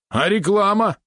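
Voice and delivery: high, outraged question; indignant. A narrator's reading. Valeron objects to a basic spider, high indignation. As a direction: let the pitch leap in protest. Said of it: "возмутился Валерон".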